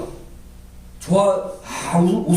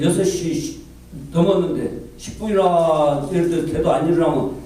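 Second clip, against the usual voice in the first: ordinary delivery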